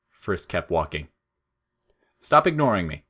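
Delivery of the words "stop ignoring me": higher, louder petulant snap; irritated demand. Acted. Flowey annoyed that Frisk keeps walking, a petulant snap.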